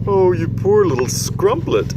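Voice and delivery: crying out in pity. Acted. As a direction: giant crying out in sorrow for the orphan. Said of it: "cried the B F G".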